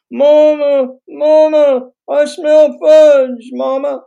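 high, loud, drawn-out whine through the wall; whining child. A narrator's reading. Children calling to mama from the kitchen, whining. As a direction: whine like children pestering their mother. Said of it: "came John's whining voice and then Jerry's".

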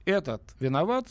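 normal talking voice

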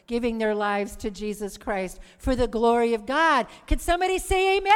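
loud call for an amen, building to a shout; rally the congregation at full volume; rousing preaching. Real, not acted.

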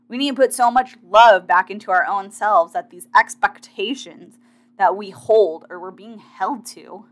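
ordinary talk, nothing marked

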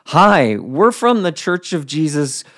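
loud, laughing, tripping over the words; flustered blurt. Retold or imitated. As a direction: blurt it out in a panicked rush. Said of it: "That's how I said it".

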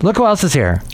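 ordinary delivery

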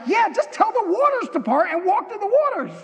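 high-pitched, casual mock reply; breezy divine instruction. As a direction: toss off the impossible as obvious. Acts out God's casual instruction.

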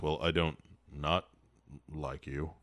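low, soft, halting Batman; awkward delivery. Acted. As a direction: mumble it awkwardly like a gruff hero. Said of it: "a real awkward delivery".

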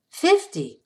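put-on amazed, high Eastern European accent; hint of an Eastern European woman. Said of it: "with a heavy Eastern European accent".

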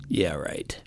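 low whispered yeah right; sarcastic whisper. Real, not acted. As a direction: scoff it under your breath. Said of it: "sarcastic whisper".

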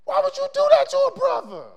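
high, loud anguished plea; retold cry to God. Preacher retelling his cry to God, high and loud. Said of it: "I'm like".

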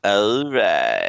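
cartoon catchphrase, long and drawn out; Quagmire. Co-host answering with cartoon impression, drawn-out catchphrase. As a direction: stretch it out in a put-on cartoon voice. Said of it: "Um, Quagmire".

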